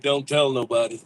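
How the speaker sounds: ordinary talk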